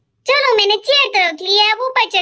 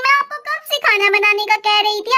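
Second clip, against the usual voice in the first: ordinary talk, nothing marked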